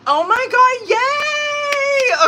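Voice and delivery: very high, loud, stretched cheer; excited squeal. Real, not acted. Streamer celebrating good news on live, squealing. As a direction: squeal it out with real delight.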